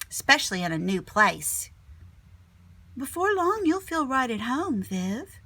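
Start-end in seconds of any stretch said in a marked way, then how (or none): 3.0-5.4 welcoming teacher. Acted. Teacher welcoming new student.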